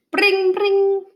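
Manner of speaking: high, bright ringing bell sound; bell imitation. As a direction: ring out like a school bell.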